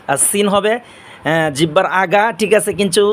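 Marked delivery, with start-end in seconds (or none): none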